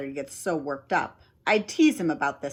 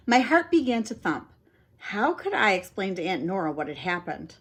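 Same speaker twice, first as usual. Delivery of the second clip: ordinary delivery, nothing marked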